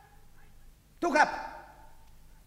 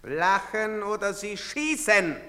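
usual speaking voice

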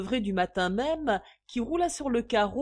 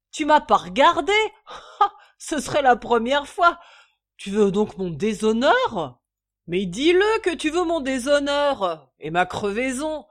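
higher, loud, laughing bluster; outraged drunk; 4 semitones higher, 9 dB louder than usual. A narrator's reading. Drunk father denying he's drunk, loud. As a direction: play wounded pride.

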